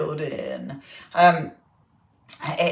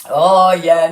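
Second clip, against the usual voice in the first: normal talking voice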